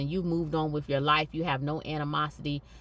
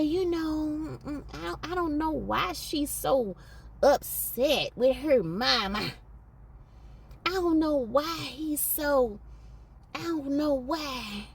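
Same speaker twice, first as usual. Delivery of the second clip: playing dumb; 8 semitones higher than usual. Vlogger imitating enablers who play dumb, high and mock-innocent. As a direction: act dumb, hemming and pretending not to know. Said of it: "they act dumb too".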